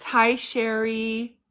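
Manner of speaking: ordinary delivery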